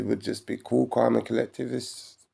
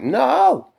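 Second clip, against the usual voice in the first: high, slow, squeaky mocking woman; shrill mock of a woman wanting it all; high mock woman's demand; 12 semitones higher, 4 dB louder, slower than usual. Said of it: "they're like".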